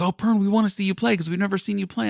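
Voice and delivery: high, fast teasing chorus voice; mock-eager coworkers egging him on; mimicking the employees. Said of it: "they're like".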